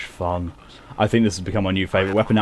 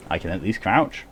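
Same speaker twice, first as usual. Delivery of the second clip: ordinary talk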